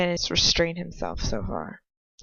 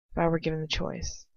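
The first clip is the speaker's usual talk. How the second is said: plain reading voice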